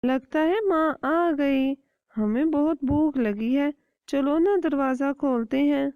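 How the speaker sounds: high, hungry eager chicks; a touch of chick excitement; excited chicks. Acted. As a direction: urge the others to open.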